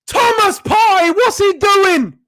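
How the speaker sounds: high, slow disbelieving yell; exasperated shout. Real, not acted. Fan shouting in disbelief at Partey's giveaway, real exasperation. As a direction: drag out the disbelief.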